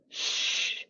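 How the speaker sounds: sustained soft shush; unvoiced sh. Shown as a demonstration. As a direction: let out a steady sh with no voice. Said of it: "it's the voice version of sh".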